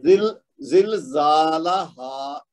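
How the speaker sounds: very slow زلزالہا, long zaa, short la, long haa; measured vowel lengths. Shown as a demonstration. Teacher demonstrates two beats versus one, slow زلزالہا. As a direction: draw out زلزالہا as "zil-zaaa-la-haaa". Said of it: "دو حرکت تب دینی ہے".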